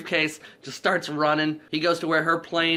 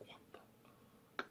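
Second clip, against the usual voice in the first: whispered mock bewilderment; imitated stunned aside; 29 dB quieter, much faster than usual. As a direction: whisper it as if walking off baffled. Woman mutters as she walks away.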